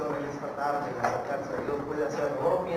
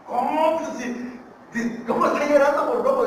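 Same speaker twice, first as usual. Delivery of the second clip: high, loud incredulous questioning; astonished disbelief; 10 semitones higher, 7 dB louder than usual. Acted. Visitor questioning the rancher's odd answer about his dog, loudly incredulous. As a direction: burst out in acted disbelief.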